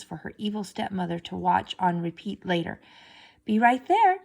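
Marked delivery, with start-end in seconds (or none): 3.4-4.2 loud, higher call down the hall; calling back. A narrator's reading. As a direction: shout the reply across the apartment. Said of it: "Cinderella called back".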